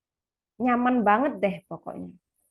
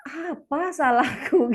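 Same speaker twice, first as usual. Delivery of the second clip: put-on confused child; 4 semitones higher, 3 dB louder, much slower than usual. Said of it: "bingung kan".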